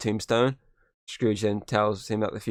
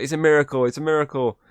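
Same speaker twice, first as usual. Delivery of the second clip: mock overjoyed Scrooge; 6 semitones higher, 5 dB louder than usual. Scrooge rejoicing in retelling.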